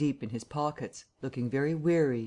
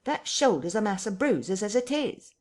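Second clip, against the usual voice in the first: higher, pained grumbling tramp voice; whining tramp; 8 semitones higher than usual. Acted. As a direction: complain like a bruised, put-upon vagrant. Marvel complains in pain.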